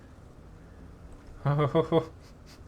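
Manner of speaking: airy laugh climbing up; a quick laugh of disbelief; breathy rising laugh. Real, not acted. Player sees a teammate about to die, airy rising laugh.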